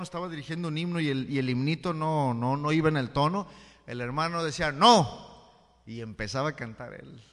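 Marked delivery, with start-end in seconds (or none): 4.7-5.8 a loud cutting "No" from the pew; sharp loud no. Retold or imitated. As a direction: cut the singer off with a loud "No". Hermano interrumpe el himno. (un "No" fuerte y cortante)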